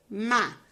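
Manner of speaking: "ma" alone, loud and distinct; isolated syllable. Shown as a demonstration. Teacher showing pupils another syllable, "ma" said loudly alone. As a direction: sound out "ma" as one loud syllable. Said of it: "Outra sílaba".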